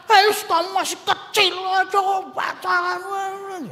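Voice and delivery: shouted high, then the last word dragged out; furious parent snapping at a child; angry scolding parent. Acted. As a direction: scold the child for dating so young.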